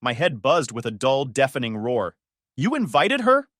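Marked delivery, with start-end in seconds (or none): 2.6-3.5 loud, high, stunned outburst; nearly losing control in disbelief; betrayed fiancé barely holding it together; barely controlled disbelief. Acted. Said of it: "My voice was barely controlled, trembling with disbelief".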